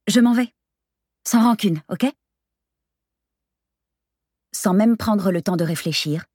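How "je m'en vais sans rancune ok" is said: higher, louder, hurried-off goodbye; uneasy brush-off with raised pitch; flustered exit. Acted. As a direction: insist on leaving, on edge. Flustered narrator flees.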